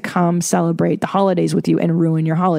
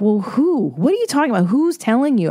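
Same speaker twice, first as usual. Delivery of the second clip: high, baffled challenge; incredulous retort; 5 semitones higher than usual. Retold or imitated. Telling people who cite society's rules, in disbelief. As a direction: push back, genuinely baffled. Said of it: "And I'm like".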